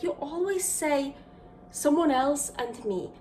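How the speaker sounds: normal talking voice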